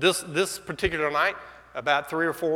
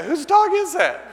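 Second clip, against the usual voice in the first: much higher and louder, stunned; astonished; 9 dB louder than usual. Retold or imitated. Husband to wife about a strange dog, stunned high cry. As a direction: blurt it out in shock.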